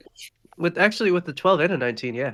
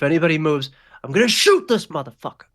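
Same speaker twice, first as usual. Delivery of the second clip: raised voice climbing to a loud threat; yelled threat; 3 semitones higher, 6 dB louder than usual. Acted. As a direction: build to a yell, punching the high peak. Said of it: "a loud sound of somebody yelling".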